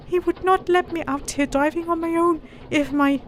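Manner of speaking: a lad explaining himself; boy voice. Acted. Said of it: "came the reply".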